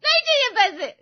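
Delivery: loud, high-pitched tearful refusal; bitter sobbing. Acted. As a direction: wail it out, crying theatrically. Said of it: "फूट फूट कर रोने लगती है".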